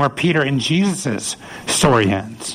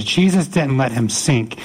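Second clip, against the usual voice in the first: ordinary talk, nothing marked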